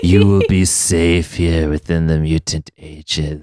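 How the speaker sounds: slow, low spooky haunted house voice; Caliban voice. Pretend. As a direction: creep out each name slowly, like a haunted house host. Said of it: "do that Caliban voice again".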